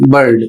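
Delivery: बर्ड said clearly with a short "ur" sound; model pronunciation. Shown as a demonstration. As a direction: pronounce बर्ड as a teacher would. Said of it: "इसका उच्चारण होगा".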